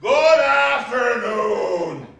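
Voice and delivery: slow, loud, drawn-out goodbye; final dismissal. Acted. Scrooge dismissing his nephew for good, slow and loud. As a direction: lay down the last word slowly.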